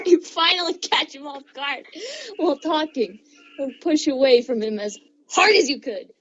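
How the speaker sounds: usual speaking voice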